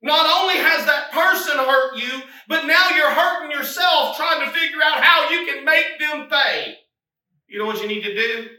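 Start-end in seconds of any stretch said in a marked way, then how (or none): 0.0-6.8 loud, high, driving exhortation; rally the congregation; preaching at full force. Acted. Preacher urging the church to give it to Jesus, fervent.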